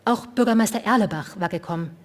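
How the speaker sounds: usual speaking voice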